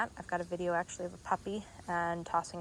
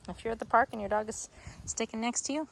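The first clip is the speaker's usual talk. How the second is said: ordinary talk, nothing marked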